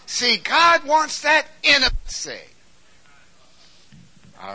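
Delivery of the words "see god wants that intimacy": very high, very loud declaration; shouted preaching. Real, not acted. Preacher proclaiming God's desire for intimacy, shouting. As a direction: proclaim it with full force.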